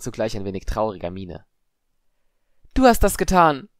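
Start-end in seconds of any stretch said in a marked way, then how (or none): 2.7-3.7 high, loud shriek of accusation; accusing scream. A narrator's reading. Padma accusing Harry before everyone, screaming. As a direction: hurl the blame at full voice. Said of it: "schrie Padma".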